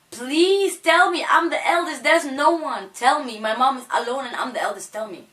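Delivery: high, loud, desperate pleading; desperate begging. Retold or imitated. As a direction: beg them to tell you, voice straining high. Child begging police, desperate.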